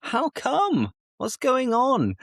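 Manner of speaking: high-pitched mock surprise; imagined listener. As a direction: play a startled viewer noticing the mustache. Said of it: "you're probably thinking".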